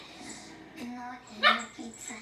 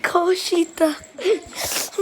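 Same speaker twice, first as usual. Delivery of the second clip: slow call; 3 semitones higher, 5 dB quieter, much slower than usual. Real, not acted. Calling the puppy.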